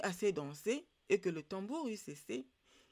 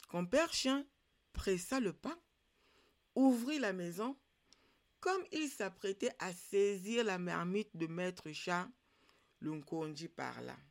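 ordinary delivery

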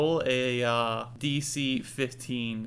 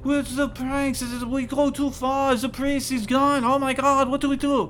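high, loud frantic confession; panicked chicken; 13 semitones higher, 7 dB louder than usual. Acted. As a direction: babble it out as a terrified chicken. Chicken confesses to dosing priest.

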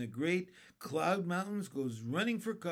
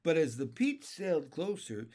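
usual speaking voice

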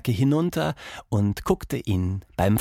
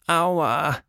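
a lightly voiced high little ouch; a child's mild yelp of pain after a fall; 7 semitones higher than usual. Tilda after falling off the swing, small high ouch.

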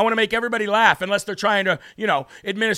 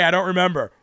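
ordinary delivery

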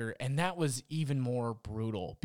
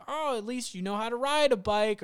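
backhanded quip; 8 semitones higher than usual. Retold or imitated. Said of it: "say some backhanded thing and be like".

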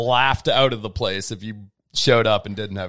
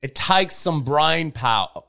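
slow, exaggerated Aussie vowels; laboured, hammed-up Aussie drawl; broad Australian accent; slower than usual. Shown as a demonstration. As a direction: stretch "takes" and "brain" toward "tykes" and "brine" in mock Australian. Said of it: "I can do an Australian accent".

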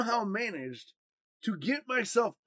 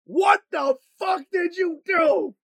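shouted, much higher and louder, slow; furious yell; 11 semitones higher, 9 dB louder, slower than usual. Retold or imitated. Mother to her son after the prank, furious yell. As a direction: mom screaming at her son. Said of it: "rips the head off me, and goes".

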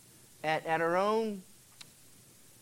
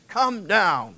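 commanding order; 2 semitones higher, 5 dB louder than usual. Shown as a demonstration. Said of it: "notice it's".

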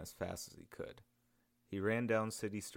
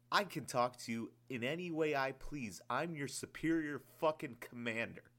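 higher, louder angry rant; hurled anger; 6 semitones higher, 4 dB louder than usual. Acted. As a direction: hurl it down at him. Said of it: "the man continued to hurl".

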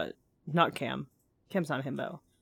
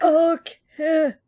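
scared cry; 9 semitones higher, 5 dB louder than usual. Real, not acted. Horror scene, scared reaction.

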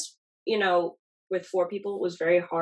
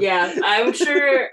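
sarcastic; 5 semitones higher, 6 dB louder, much slower than usual. Real, not acted. Sarcastic slow agreement.

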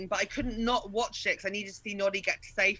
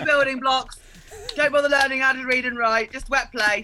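louder, sarcastic list of rules; mock bossy decree; 7 dB louder than usual. Mocking a nursery's rules to friends, loud and sarcastic. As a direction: lay down silly rules like a strict nursery teacher.

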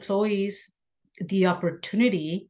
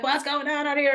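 mock shout pitched higher, imitating her own outburst; retold screaming; 8 semitones higher than usual. Boss retelling how she yelled at the shop crew, mock high shout. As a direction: replay the yell at the crew, raised up high. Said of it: "I go out screaming at the shop".

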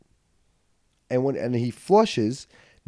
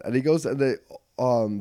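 ordinary delivery

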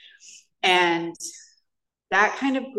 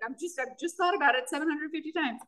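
mock fretting; 9 semitones higher, 5 dB quieter than usual. Imagined obsessive self-talk.

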